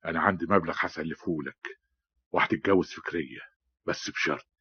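low, hushed scheming tone; confidential offer. Acted. Schemer offering his friend a loan, confidential.

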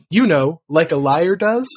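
usual speaking voice

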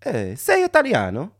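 put-on high, loud Italian-accented call; Italian yell. Stranger yelling from his car, Italian accent. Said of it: "he starts yelling out of the car".

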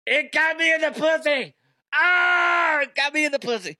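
high, shrieking mock victim; comic agonized shriek; hammed-up screaming. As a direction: imitate a wounded woman screaming. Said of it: "and she starts screaming".

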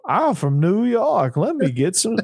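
a Yankee faking a Southern twang; mock Southern accent. Co-host parodying the coach's put-on Southern voice. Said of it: "Like that".

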